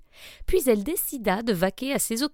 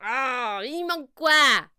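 sorcière impatiente; 4 semitones higher, slower than usual. Acted.

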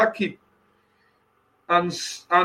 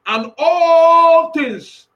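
higher, louder, very slow; booming Jehovah; 6 semitones higher, 5 dB louder, much slower than usual. Acted. Preacher quoting Jehovah, building to a peak. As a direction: stretch it out with awe. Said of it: "Because Jehovah says".

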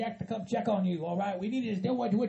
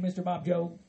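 ordinary talk, nothing marked

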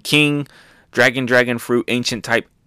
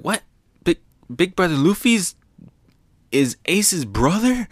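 Otama voice; 7 semitones higher than usual. Retold or imitated. Otama learns Luffy is Ace's brother, shocked in a higher voice. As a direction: do Otama gasping at the news.

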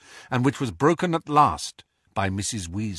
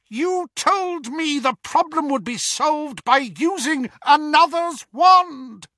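much higher, louder scream; 5 dB louder than usual. A narrator's reading. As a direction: shriek it with cold rage. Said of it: "a voice screamed inside his head".

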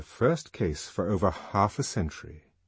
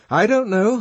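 much higher, louder admission; finally admitting ignorance; 13 semitones higher, 10 dB louder than usual. A narrator's reading. Helmholtz answering at last after silence, raised voice. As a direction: burst out with it, voice raised high. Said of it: "he said at last".

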